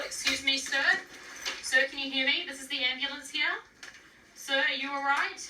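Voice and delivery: loud, firm call to rouse a patient; calling out loudly. Acted. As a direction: raise your voice to wake him. Paramedic rousing unresponsive patient.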